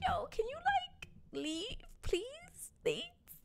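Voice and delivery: sweet and dismissive, lift it way up; fake polite brush-off. Telling the stranger to leave, high fake-polite. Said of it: "And I'm like".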